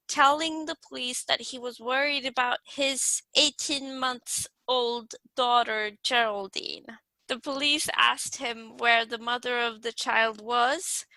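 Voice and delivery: usual speaking voice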